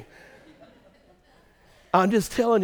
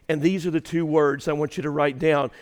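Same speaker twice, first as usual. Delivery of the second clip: ordinary talk, nothing marked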